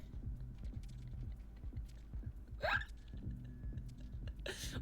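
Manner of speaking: high breathless wheezing laugh; helpless laughter with no voice; very high airless laugh fit; long silent laugh fit. Real, not acted.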